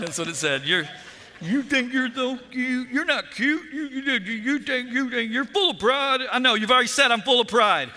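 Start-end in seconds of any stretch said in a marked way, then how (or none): 0.6-6.2 high, babbling mock scold; hammed-up hate-mail voice; mock taunting. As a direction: pile up insults in a high voice. Exaggerating the insulting letter.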